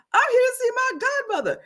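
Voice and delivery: high, fast retold reply; imitating the ex. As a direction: rattle off his excuse.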